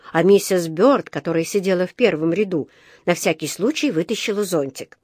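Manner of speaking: plain reading voice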